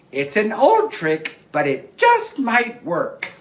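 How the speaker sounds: high squeaky frog voice, fretful; a worried little frog panicking. Acted. Little Frog waking late and panicking about the lion.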